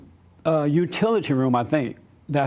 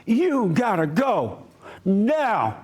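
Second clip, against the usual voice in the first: retelling the manager going off at him, a bit higher and slower; a lightly played angry order; the manager ordering him out, in a mild mock yell; 4 semitones higher, much slower than usual. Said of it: "Scott was going off".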